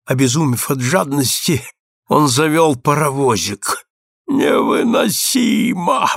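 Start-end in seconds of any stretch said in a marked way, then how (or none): 4.3-6.1 high, slow, drawn-out wail; anguished repetition. A narrator's reading. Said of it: "повторил он, побледнев". (протяжно, страдальчески)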